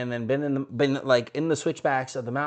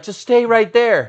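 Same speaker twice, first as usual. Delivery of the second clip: guide calling out; 8 semitones higher, 9 dB louder, slower than usual. Retold or imitated. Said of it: "And he's like".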